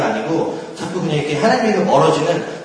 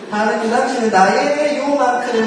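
mock confession; 6 semitones higher than usual. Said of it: "그래서 하나님 일부분".

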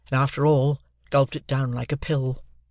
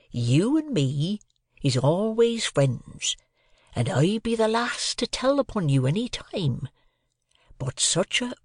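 slow, swooping Joe, raised in pitch; confidential; 7 semitones higher, slower than usual. A narrator's reading. Joe to Pip as if quite alone, confidentially. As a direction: confide earnestly, not whispered. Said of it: "speaking in a confidential voice".

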